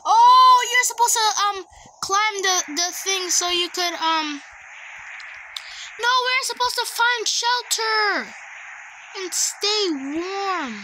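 loud, high and excited; rushing out game tips. Real, not acted.

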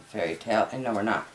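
ordinary talk

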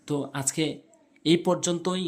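usual speaking voice

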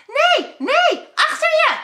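high, loud panicked cry; shouting warning. Acted. Ad warns Job of the cliff, panicked shout. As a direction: scream the warning before he falls. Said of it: "roept Ad".

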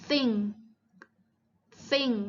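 "thing" with the tongue tip touching behind the upper teeth; TH articulation demo. Shown as a demonstration. Teacher showing students the TH in "thing", demonstrated clearly. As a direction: say "thing" with a TH that is close to, but not quite, a T. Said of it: "แตะที่ด้านหลังฟันบน".

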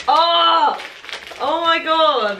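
high, loud gasping cry of delight; surprised delight. Real, not acted. Opening a Jellycat present, a loud high shriek of surprise. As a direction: let it burst out at the reveal.

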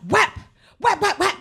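high, punchy slap sound effects; imitated slaps. Comic imitation of mother hitting daughter, slapping sounds. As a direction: mimic slaps with loud, high yelps.

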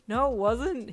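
wholehearted. A narrator's reading. Hawks reassuring his embarrassed partner, wholeheartedly. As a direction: insist with full conviction. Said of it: "he replied wholeheartedly".

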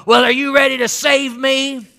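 imitated rough guy. Rough guy bursting into the pastor's office, mock demand. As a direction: blurt it as the gruff newcomer, lightly acted. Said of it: "He just collapsed in the chair".

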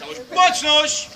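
barked parade-ground command; drill command. Acted. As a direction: shout it like a drill sergeant. Military commands to cadets.

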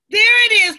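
high, loud exclamation; excited pointing out. A narrator's reading. Kojo spotting the big rock for Amma, excited. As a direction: burst out as you spot it.

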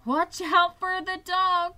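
high, loud, stretched-out cry; cried warning. A narrator's reading. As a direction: cry a slow warning as a sheep. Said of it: "cried the sheep".

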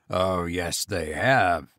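low, slow sly goblin. A narrator's reading. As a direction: taunt him low and slow. Goblin voiced in story.

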